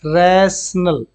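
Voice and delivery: Rational drawn out very slowly; teacher slowly modelling rational; slow pronunciation demo. Shown as a demonstration. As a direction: model rational slowly, each sound clear. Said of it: "क्या होता है?".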